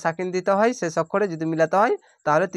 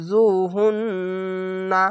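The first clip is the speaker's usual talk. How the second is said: slow, drawn-out chanted recitation; Quranic recitation; much slower than usual. Shown as a demonstration. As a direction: recite each word slowly with tajweed. Recited demo.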